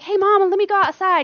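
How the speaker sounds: put-on loud, high, eager child voice; imitated kid. Kid begs mama to play outside, loud high imitation. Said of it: "Your kid's like".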